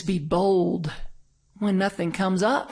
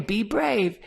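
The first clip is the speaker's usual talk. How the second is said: put-on toddler voice; 3 semitones higher than usual. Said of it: "She's always saying".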